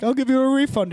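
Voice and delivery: mock curator. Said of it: "He's like".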